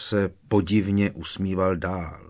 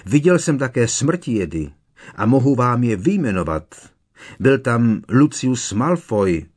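raised, loud insistent declaration; forceful testimony; 5 semitones higher, 8 dB louder than usual. A narrator's reading. Boy naming culprits to the disbelieving minister, insistent. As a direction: declare it high and loud, as an accusation. Harry accuses, naming witnessed Death Eaters.

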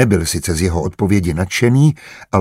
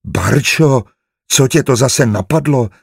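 ordinary delivery

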